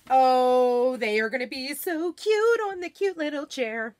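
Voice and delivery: gushing sing-song, pitch swooping up and down. Real, not acted. Host gushes over cute pillows to viewers, sing-song voice. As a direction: coo it in a lilting sing-song. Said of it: "that's my sing-song voice".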